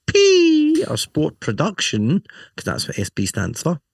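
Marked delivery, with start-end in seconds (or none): 0.1-0.8 "the S P" much higher, louder and slow; squeaky high P. Shown as a demonstration. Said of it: "say the P like that all the time".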